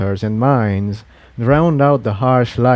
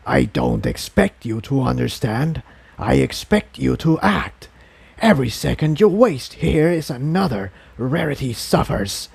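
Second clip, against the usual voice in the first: inner voice. A narrator's reading. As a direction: urge him on like a hidden presence. The inner voice commands.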